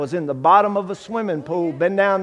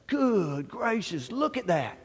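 slow, soft, wide-eyed amazement; awed hush; 9 dB quieter, slower than usual. Retold or imitated. Young man staring at his mother's Bible, hushed and awed. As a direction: let the size of it stun you quietly.

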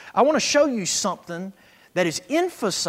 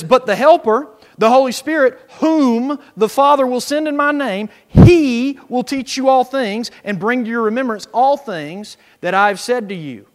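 preached Scripture reading; 4 semitones higher, 10 dB louder than usual. Acted. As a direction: proclaim the verse to the congregation. Reads Scripture aloud.

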